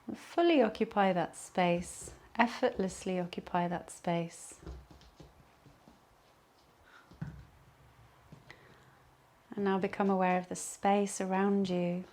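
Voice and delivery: calm Yoga Nidra voice with long pauses; guided meditation. Acted. Leads a relaxation meditation.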